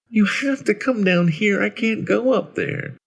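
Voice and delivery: put-on high-pitched child voice; high, small, insistent child; little boy. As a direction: plead like a small stuck boy. Stuck boy answers from the dark.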